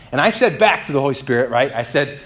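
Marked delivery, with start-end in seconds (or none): none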